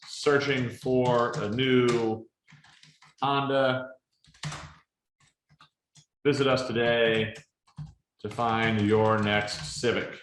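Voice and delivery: very slow, drawn-out words as if typing them; dictating while typing. Shown as a demonstration. Presenter slowly dictates a car ad script while typing it, drawn out. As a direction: sound out each word slowly as you type the script.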